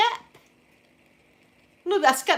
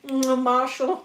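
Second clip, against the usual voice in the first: "Mm, Marshall" moaned dreamily, a bit louder; mock sleep-talk moan of "Mm, Marshall"; sleepy moan; 3 semitones higher, 4 dB louder, slower than usual. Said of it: "I must have been like".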